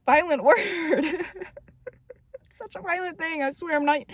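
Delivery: high voice breaking into laughter; helpless laughing. Real, not acted. Vlogger aghast at an insane death scene, laughing helplessly. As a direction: laugh through the words, losing volume. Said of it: "I'm just laughing because it's insane".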